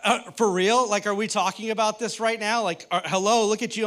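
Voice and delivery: loud, disbelieving comeback; incredulous wife. Retold or imitated. Wife scoffing at her couch-bound husband, loud disbelief. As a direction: throw his critique right back at him, loudly. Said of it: "And she's just like".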